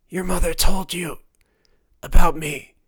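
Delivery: loud, strained, parched rasp; dying of thirst. A narrator's reading. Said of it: "sounded like a man dying of thirst".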